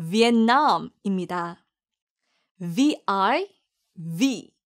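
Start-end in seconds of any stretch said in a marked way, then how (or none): 2.6-4.5 a long buzzing V with the lip on the teeth; slow V. Shown as a demonstration. Teacher shows students a clear V sound. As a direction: hold the V, lips not closed. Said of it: "V 발음을 잘 살리시고".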